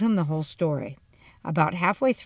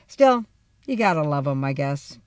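plain reading voice

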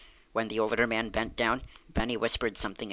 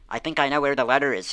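excited cry; 4 semitones higher, 6 dB louder, faster than usual. Acted. Benny giving himself away to the Percys, excited and loud. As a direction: burst out with the news. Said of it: "he cried".

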